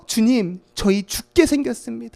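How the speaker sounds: loud, high frantic begging; alarmed, rising urgency; panicked plea. Acted. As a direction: plead as the boat goes down. Disciples panicking in sinking boat.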